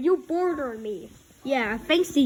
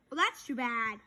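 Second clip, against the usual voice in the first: ordinary delivery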